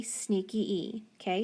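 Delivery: usual speaking voice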